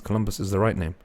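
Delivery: ordinary delivery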